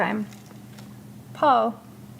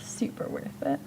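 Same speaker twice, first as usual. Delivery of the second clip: whispered, much softer; whispering; 8 semitones lower, 11 dB quieter than usual. Real, not acted. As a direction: whisper it to yourself. Repeated in a whisper.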